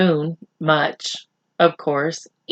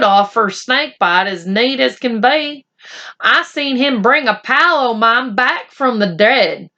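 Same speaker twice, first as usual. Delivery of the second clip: Boone the cowboy; 5 semitones higher than usual. Acted. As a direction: spin a tall tale like an old cowboy. Boone boasts about the doctor.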